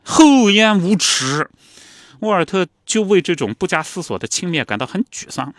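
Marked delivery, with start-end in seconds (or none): 0.0-1.5 scornful father. Retold or imitated.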